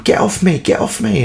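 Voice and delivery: high pleading get off me; struggling kid shouting to be let go; mock yell. Alex held by the neck, mock yell at the man. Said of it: "say to him".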